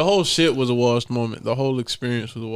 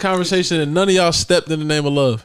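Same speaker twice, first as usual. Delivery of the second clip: raised, heated calling out; loud callout; 6 semitones higher, 6 dB louder than usual. Retold or imitated. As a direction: call them out loudly. Calling out guests at party.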